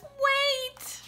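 high, held cry to stop everyone; acted high-pitched interruption; urgent calling out. A narrator's reading. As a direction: call a halt in a high storybook voice. Bear stops the friends, story.

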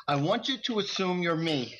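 lightly bossy, half laughing; a playful boss giving orders; mock bossy order. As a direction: tell the angels off in jest. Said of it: "I said".

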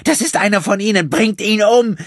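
high, loud raging scream; furious scream. Acted. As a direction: scream for his death. Gina screams for his death. (hasserfüllter, schriller Schrei)

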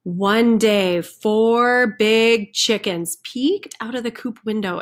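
ordinary delivery, nothing marked

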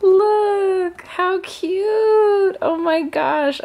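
excited. Real, not acted. Showing viewers a card, a high excited gush. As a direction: squeal it out over the card. Cute card just opened.

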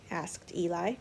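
usual speaking voice

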